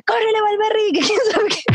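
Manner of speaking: high mock yell from the stands; dad shouting. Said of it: "Y mi papá gritándome".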